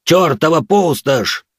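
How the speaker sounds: a roaring, slow dwarf's curse. Acted. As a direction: bellow it out like an angry dwarf. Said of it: "в полный голос взревел гном".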